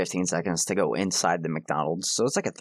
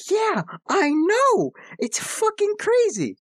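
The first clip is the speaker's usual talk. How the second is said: excited squeal; 3 dB louder, slower than usual. Acted. Hyped reaction to cousin.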